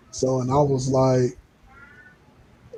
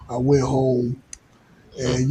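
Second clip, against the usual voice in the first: usual speaking voice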